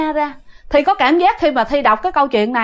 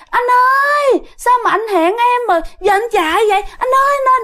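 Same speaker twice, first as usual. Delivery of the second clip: high, insistent calling; coaxing ghost woman; 10 semitones higher than usual. Acted. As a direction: keep calling after him, high and wheedling. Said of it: "nói cái giọng như là cái kiểu".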